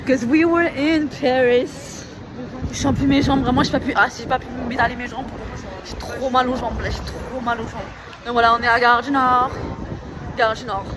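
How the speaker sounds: loud and high, buzzing with fatigue; near-shouting over station noise; loud, excited. Real, not acted. Exhausted shouting at station late. (fort et aigu, surexcitée de fatigue)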